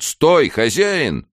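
high, loud, drawn-out shout; slow, carrying call to halt; shouted order. A narrator's reading. Lieutenant halting the householder at his porch, shouting. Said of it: "крикнул лейтенант".

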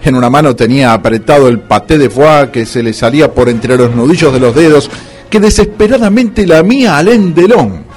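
ordinary talk, nothing marked

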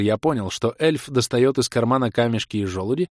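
usual speaking voice

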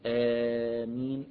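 آمين with the first alif stretched slowly to four counts. Shown as a demonstration. Teacher showing the middle length of آمين, drawn out. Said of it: "ممكن يقول".

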